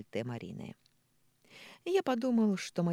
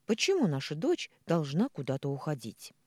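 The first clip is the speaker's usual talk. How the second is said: plain reading voice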